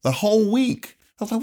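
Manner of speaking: usual speaking voice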